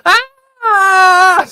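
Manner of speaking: put-on shrill eager scout kid yell "Ah!"; high-pitched Boy Scout scream. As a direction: let out a high boyish battle scream. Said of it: "high-pitched, like, Boy Scout voice".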